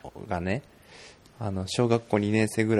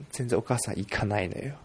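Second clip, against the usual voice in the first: ordinary delivery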